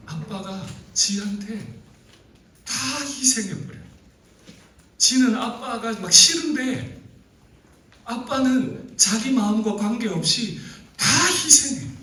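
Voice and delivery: high, loud and slow, building with feeling; impassioned preaching. Acted. Preacher retelling the daughter's frustration, high and impassioned. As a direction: pour heat into the daughter's frustration.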